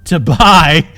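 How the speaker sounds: loud, scoffing burst; scornful laugh. Acted. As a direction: laugh the offer off. Captain scoffs, then howls.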